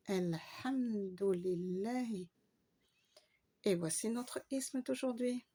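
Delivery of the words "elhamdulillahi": Elhamdulillahi said slowly and joined up; slow demo. Shown as a demonstration. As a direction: read Elhamdulillahi slowly in one go. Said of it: "joins the demonstrated words". (Elhamdulillahi lentement, d'un seul souffle)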